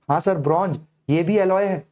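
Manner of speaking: ordinary talk, nothing marked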